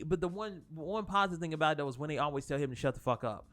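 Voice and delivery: ordinary talk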